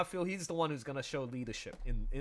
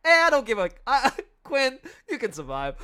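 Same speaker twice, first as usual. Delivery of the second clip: dismissive, much louder and higher mockery; mock careless; 7 semitones higher, 11 dB louder than usual. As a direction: brush it off, laughing at the drowning friend. Mocking careless friend.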